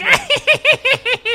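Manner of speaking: high, loud cackling laugh of a dying man; shrieking mock maniac laugh. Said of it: "he's laughing and being like".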